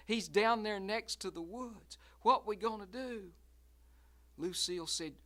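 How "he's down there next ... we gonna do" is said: higher, louder worried voice; alarmed and unsure; scared question. Acted. Russell asking Lucille what to do, alarmed.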